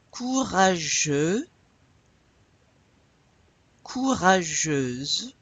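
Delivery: Courageux and courageuse drawn out very slowly; slow model. Shown as a demonstration. Teacher slowly modelling words for the class to repeat. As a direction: stretch every syllable for repetition. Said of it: "Say that again with me".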